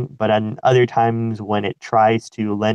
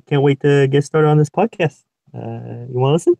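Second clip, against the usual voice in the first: jokey attempted Kiwi accent, pitched higher; 4 semitones higher than usual. Cohost greeting podcast listeners in a put-on Kiwi accent. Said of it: "Kiwi accent or what is it".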